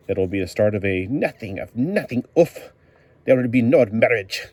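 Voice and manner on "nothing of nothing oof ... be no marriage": hammed-up German accent that slips toward Russian; comic-book German, badly faked. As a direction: put on a loud, higher mock German accent. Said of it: "one of my German sounds like a Russian sometimes".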